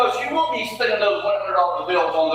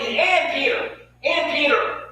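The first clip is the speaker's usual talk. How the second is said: "and Peter" raised in pitch and stressed; emphatic repetition; 5 semitones higher, slower than usual. Retold or imitated. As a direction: punch "and Peter" each time, higher, then stop. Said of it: "he's emphatic".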